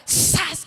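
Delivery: loud, sudden whoosh of "zas"; imitated wind blast. Hermana telling how the roof burst upward, imitated blast. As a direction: imitate a strong gust slamming down. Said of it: "Como si de esos vientos fuertes".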